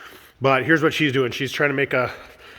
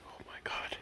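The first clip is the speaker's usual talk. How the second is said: whisper; 21 dB quieter than usual. Real, not acted. Amazed at the finished steps.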